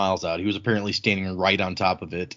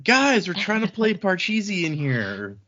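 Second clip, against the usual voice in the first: mock annoyed gamer; 8 semitones higher than usual. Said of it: "and someone's like".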